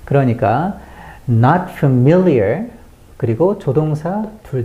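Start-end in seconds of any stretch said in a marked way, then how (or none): 1.3-2.7 slow, strong "not" and middle of "familiar"; stress NOT and MIL. Shown as a demonstration. Teacher models for learners, stressed "not" and "mil" in "familiar". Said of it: "not은 크게 강조해 주셔야".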